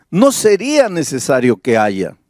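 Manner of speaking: ordinary talk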